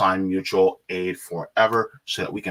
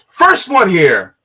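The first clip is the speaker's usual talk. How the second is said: loud, high, drawn-out shout-out; excited shout-out; 8 semitones higher, 10 dB louder, slower than usual. Real, not acted. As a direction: announce it big and slow. Calling out chat greeting.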